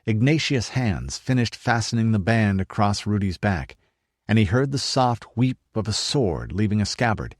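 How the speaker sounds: ordinary delivery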